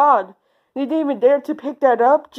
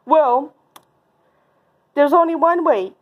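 ordinary talk, nothing marked

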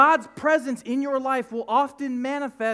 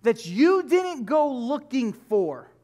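slow emphatic point; 4 dB louder, much slower than usual. Acted. Preacher lands key point.

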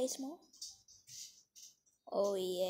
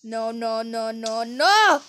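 panicked no; 10 dB louder than usual. Real, not acted. Something going wrong.

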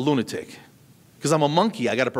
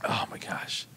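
breathy aside under the breath; whisper; 5 semitones lower, 11 dB quieter, slower than usual. Real, not acted. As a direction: whisper it to yourself. Whispered aside after laughter.

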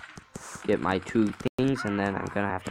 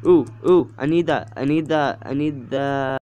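higher and louder, eager repeated want; excited grabbing; 5 semitones higher, 7 dB louder than usual. Real, not acted. Boy playing a game with his sibling, excited. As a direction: chant it like you can't let the loot get away. Spots an item he wants in-game.

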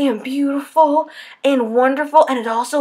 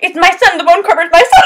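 quoting the heroine; 4 semitones higher, 7 dB louder, faster than usual. Retold or imitated. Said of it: "she's like".

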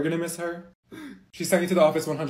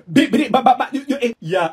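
fast-sputtered nonsense syllables, higher and louder; invented language; 5 semitones higher, 5 dB louder than usual. Acted. Friends chattering in their secret language, invented gibberish. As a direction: babble loudly in a made-up language. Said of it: "speaking our language".

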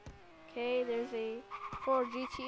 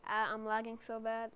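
ordinary talk, nothing marked

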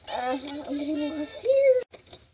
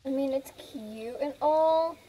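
ordinary talk

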